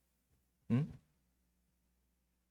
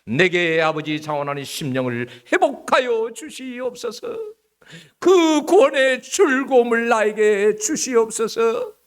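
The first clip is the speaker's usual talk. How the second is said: slow, louder earnest prayer, higher; a fervent psalm prayer voice; praying like David; 10 semitones higher, 8 dB louder than usual. Pretend. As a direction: plead to God as David. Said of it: "다윗처럼".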